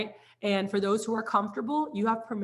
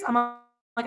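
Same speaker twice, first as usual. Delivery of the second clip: ordinary talk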